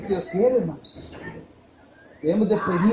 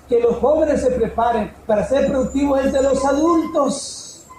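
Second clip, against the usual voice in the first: raised, driving preacher's voice; preaching at full force; 5 semitones higher, 5 dB louder than usual. Acted. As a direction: preach it out to the church. Pastor preaching to congregation. (predicando con fuerza ante la congregación)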